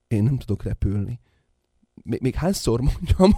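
usual speaking voice

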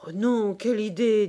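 loud, emphatic dismissal of an idea; acted startled denial; exclaimed protest. A narrator's reading. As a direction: protest at once, with force.

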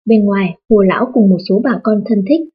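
ordinary delivery, nothing marked

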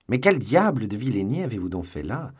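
loud, high outraged question; indignant reproach. A narrator's reading. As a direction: demand an explanation, scandalised. Porthos reproaches D'Artagnan.

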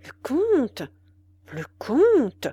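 puzzled and mildly displeased; displeased muttering. A narrator's reading. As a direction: repeat it in discontented disbelief. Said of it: "murmura l'officier d'un air mécontent".

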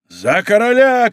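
high, drawn-out rallying shout; battle cry. A narrator's reading. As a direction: cry it to lead the charge. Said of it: "кричал Д'Артаньян".

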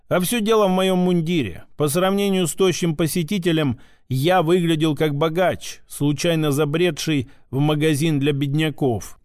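ordinary delivery

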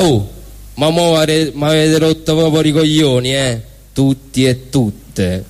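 usual speaking voice

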